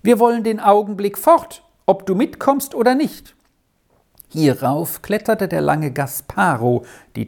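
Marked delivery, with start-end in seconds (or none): 0.0-3.4 high, ringing call from afar. A narrator's reading. Lady calls to her donkey boy, impatient high call. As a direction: call across to the boy, pitch well raised. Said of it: "Jetzt tönte Helminas Stimme".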